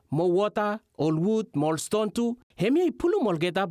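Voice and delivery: usual speaking voice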